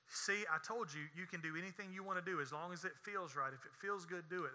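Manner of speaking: very soft, low insinuating murmur; hushed tempting voice. Retold or imitated. The song speaks to our true self, hushed coaxing. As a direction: drop to a near-hush, coaxing.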